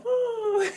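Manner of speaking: high, joyful whoop; excited whoop. Real, not acted. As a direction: cheer it out, delighted. Cheering over finished dish.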